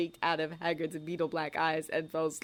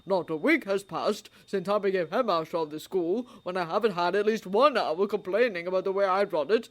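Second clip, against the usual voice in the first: put-on louder, gently teasing old man; old wizard voice; 3 dB louder than usual. A narrator's reading. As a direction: tease kindly. Dumbledore's voice.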